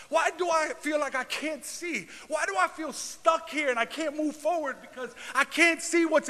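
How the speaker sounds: high, pleading preacher's cries; preaching lament. Acted. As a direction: cry out the questions to God.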